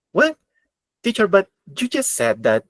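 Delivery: puzzled student. Acted. Student interrupting the teacher over a new rule, mock puzzlement. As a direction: raise your voice into a mock-confused student's protest.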